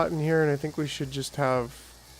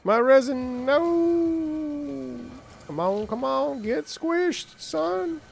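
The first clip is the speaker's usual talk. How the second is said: excited squeal; 11 semitones higher, much slower than usual. Real, not acted. Panicking over lost resin.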